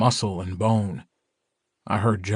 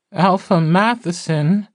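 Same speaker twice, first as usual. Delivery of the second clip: high, loud, drawn-out call; shrill character voice, pitched way up; 9 semitones higher, 5 dB louder than usual. A narrator's reading. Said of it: "a shrill voice called".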